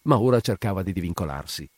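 plain reading voice

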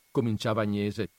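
plain reading voice

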